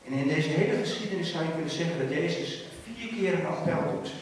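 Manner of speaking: ordinary talk, nothing marked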